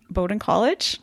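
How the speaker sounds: usual speaking voice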